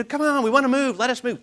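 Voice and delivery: high, loud mock begging; impatient pleading. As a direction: badger them like restless kids, hammed up. Legs acted pleading to move.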